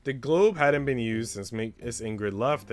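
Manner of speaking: ordinary talk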